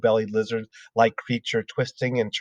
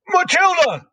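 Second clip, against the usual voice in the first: much higher, louder bark of a name; 6 dB louder than usual. Acted. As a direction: snap the girl's name out. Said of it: "she barked".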